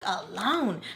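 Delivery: high, exasperated retort in retelling; disgusted. Retold or imitated. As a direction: act out being fed up, starting high. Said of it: "So I'm getting disgusted".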